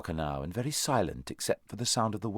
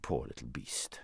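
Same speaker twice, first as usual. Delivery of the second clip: low, soft pity; tender, hushed sympathy; gentler tone; 7 semitones lower, 9 dB quieter than usual. A narrator's reading. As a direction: soften and drop the voice kindly. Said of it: "said the horse in a gentler tone".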